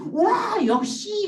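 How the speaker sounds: put-on high, loud kids' cheer; high, loud delighted wow; excited cheer. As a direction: whoop with delight like the kids. Kids cheering the answer.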